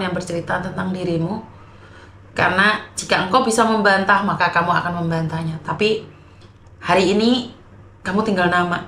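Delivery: soft, low words spoken to the departed; sincere farewell. Real, not acted. Mourning streamer speaks to the late Rizal, softly sincere.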